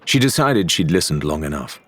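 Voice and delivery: plain reading voice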